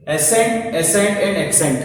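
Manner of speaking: all three words stretched out slowly; slow dictation. Shown as a demonstration. Teacher slowly reading the three homophones aloud. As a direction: read each word slowly so students hear it. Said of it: "एक होता है accent".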